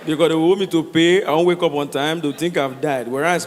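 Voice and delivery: ordinary talk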